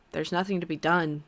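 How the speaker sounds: plain reading voice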